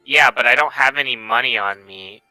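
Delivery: ordinary talk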